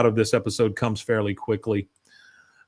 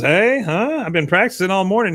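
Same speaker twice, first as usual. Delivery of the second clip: higher, quick and eager; excited kid; 7 semitones higher, 3 dB louder than usual. Retold or imitated. Boy proudly tells his dad about his slapstick, excited. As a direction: show off the trick proudly.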